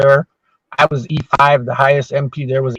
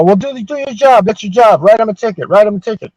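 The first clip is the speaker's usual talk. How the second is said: higher, insistent urging; pushy cooks; 7 semitones higher than usual. Retold or imitated. Cooks urging the MP to write the ticket, higher and insistent. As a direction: push him to do his job. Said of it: "and they go".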